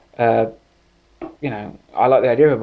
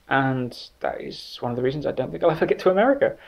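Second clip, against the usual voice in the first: usual speaking voice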